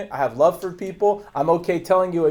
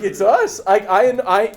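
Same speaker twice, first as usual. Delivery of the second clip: ordinary delivery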